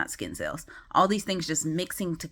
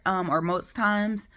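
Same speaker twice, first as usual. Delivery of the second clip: ordinary talk, nothing marked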